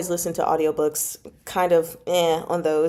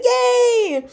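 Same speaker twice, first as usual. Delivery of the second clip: cheer; 4 dB louder than usual. Retold or imitated. Reading chat cheer.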